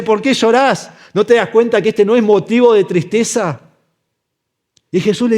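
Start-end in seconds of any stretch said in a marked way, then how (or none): 0.0-0.9 raised, insistent question in a higher pitch; incredulous questioning. Acted. Jesus questioning weeping Mary, incredulous and insistent. 1.1-3.6 higher, insistent question; incredulous questioning. Acted. Jesus challenging Mary's sadness, incredulous.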